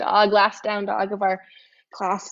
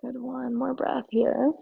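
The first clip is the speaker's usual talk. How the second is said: soft, calm; 3 semitones higher, 6 dB quieter than usual. Real, not acted. Holding the twist, calming cue.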